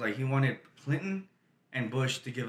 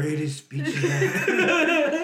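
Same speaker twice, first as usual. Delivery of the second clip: slow, drawn-out mock president; Donald Trump; slower than usual. Friend mimicking the president's weird talk, slow laughing impression. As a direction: stretch out the boast in a put-on presidential voice. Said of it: "The way he talks so weird".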